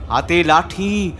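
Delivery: ordinary delivery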